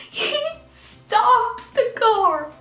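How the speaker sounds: very high, loud squealed storytelling; mock panicked squeal. Storyteller as police car stops beside them, hammed-up panicked squeal.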